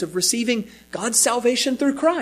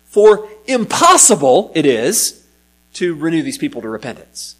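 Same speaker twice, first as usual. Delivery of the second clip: "impossible" punched louder and slowed, then "to renew these people to repentance" falls away; stress on impossible; 3 semitones lower, 4 dB louder than usual. Shown as a demonstration. Preacher reading the Greek word order aloud to the congregation, with heavy stress on "impossible". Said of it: "give that, that emphasis".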